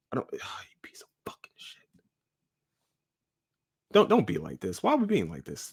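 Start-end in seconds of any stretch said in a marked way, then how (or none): 0.1-1.8 breathy whispered curse, barely audible; angry whisper. Real, not acted. Host cursing the ghost under his breath in a whisper. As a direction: spit the insult out in a whisper. Equipment cut out again.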